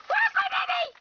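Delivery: put-on very high wail for a lost child; hammed-up frantic mom; frantic calling. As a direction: cry out high in melodramatic panic. Mother searching for daughter.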